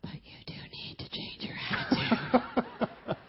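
put-on slow toddler whisper; sly whisper. Two-year-old whispering defiance to Grammy, slow and sly. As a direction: whisper it sideways, sure you're in charge. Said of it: "whispering it".

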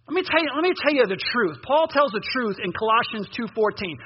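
ordinary delivery

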